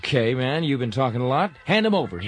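high and loud, grabby; eager demand. Acted. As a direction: reach out for the food. Eager to grab the food.

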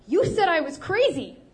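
high, loud accusation near tears; teary outburst aimed at his brother; accusing, choking back a sob. Acted. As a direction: blame him through a held-back sob. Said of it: "he accused, choking back a sob".

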